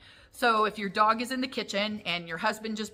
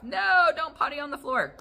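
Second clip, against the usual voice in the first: scolding the dog; 8 semitones higher, 4 dB louder than usual. Acted. Owner scolds dog about the floor, acted high scolding. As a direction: scold a dog with a raised, higher voice.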